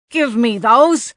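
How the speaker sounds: loud, slow angry demand. Acted. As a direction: shout it like a greedy little man. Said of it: "the small man demanded".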